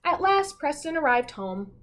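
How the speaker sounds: storybook narration, building to suddenly; story reading. A narrator's reading. Teacher reading the class a story, with a suspenseful pause. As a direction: read it like a picture book for kids.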